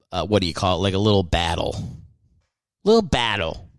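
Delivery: normal talking voice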